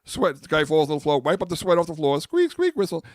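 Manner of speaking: loud, high mocking court noises; mocking basketball to friends; mock squeaks. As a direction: squeal out the game like a parody.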